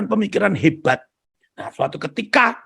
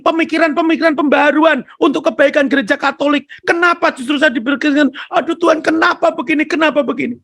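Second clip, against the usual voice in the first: high, loud desperate plea to God; a broken man shouting his grief at God; anguished crying out; 10 semitones higher, 4 dB louder than usual. Retold or imitated. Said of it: "dia menangis sejadi-jadinya".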